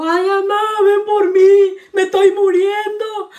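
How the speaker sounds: high, wailing whine of a sister faking distress; mock crying on the phone. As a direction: imitate her sobbing down the phone, ham it up. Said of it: "Le habló llorando a mi mamá".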